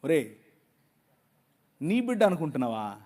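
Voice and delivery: soft, low tender rebuke; God speaking gently. Retold or imitated. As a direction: gently ask a father's question. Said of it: "ఆయన అన్నాడు". (మెల్లగా ప్రేమగా గద్దిస్తూ)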